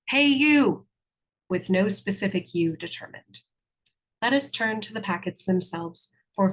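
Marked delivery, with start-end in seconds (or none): 0.1-0.9 sudden loud call-out; street yell. Retold or imitated. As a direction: shout it across the street. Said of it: "another one yells out on the street".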